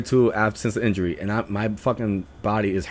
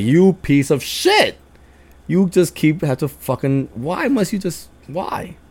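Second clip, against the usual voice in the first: mock-agonized retold complaint; pained exasperation; 6 semitones higher, slower than usual. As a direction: start cursing slowly, fade to a helpless high why. Retold reaction to shoulder hit again.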